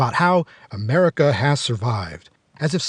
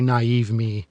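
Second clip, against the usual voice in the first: ordinary talk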